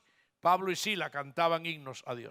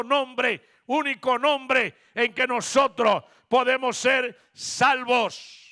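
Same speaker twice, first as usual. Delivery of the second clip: building proclamation; 6 semitones higher, 8 dB louder than usual. Acted. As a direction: repeat the name, rising into full-force preaching. Repeated name building to climax.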